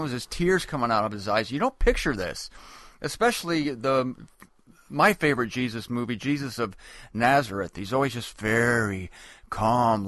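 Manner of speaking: ordinary talk, nothing marked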